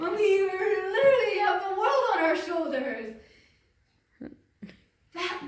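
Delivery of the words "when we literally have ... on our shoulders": passionate. Real, not acted. Passionate loud protest for women.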